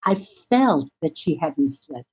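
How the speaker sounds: usual speaking voice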